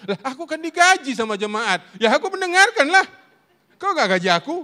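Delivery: high, loud mock indignation; hammed-up defensive outburst; indignant retort. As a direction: imitate a husband arguing back. Acts his retort to wife.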